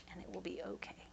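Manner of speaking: soft breathy whisper to herself. Real, not acted. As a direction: whisper it like a secret aside. Whispered aside while turning cards.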